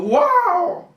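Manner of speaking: mock amazement at the teacher; amazed wow. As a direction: gasp out a wonderstruck wow. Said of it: "y dijo".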